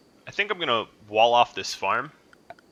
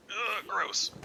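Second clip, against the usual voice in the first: high, soft grossed-out groan; disgusted ugh; 10 semitones higher, 5 dB quieter than usual. Real, not acted. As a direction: recoil in disgust. Watching animals woo by parents.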